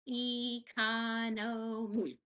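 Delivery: Economy stretched slow, each syllable clapped; slow clapped syllables for kids; clapping out syllables. Shown as a demonstration. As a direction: spell out Economy in slow claps. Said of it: "you can clap 'em with me".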